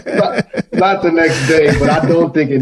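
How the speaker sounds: amused denial while laughing; laughing protest. Real, not acted. Laughing reply to teasing.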